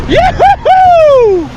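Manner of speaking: high, stretched-out whooping yoo-hoo; excited whoop. Real, not acted. Fisherman whooping about his boat on the water, a long high whoop.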